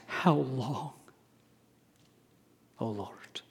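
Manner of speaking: hushed, fading to a whisper; plaintive cry. Acted. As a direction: ask it softly like a lament. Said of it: "the psalmist goes on to ask".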